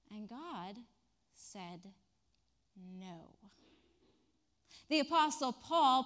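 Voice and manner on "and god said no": slow, quiet, pause before no; dramatic pause. Real, not acted. Preacher delivering God's answer, hushed. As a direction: let the no land. Repeated punchline.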